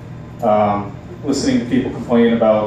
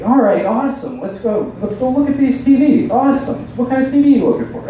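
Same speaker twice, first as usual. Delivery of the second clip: much higher, gushing salesman; overly nice salesman; 11 semitones higher than usual. Retold or imitated. Salesman pushing TVs on a shopper, high and gushing. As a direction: gush with fake eagerness. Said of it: "they're so nice".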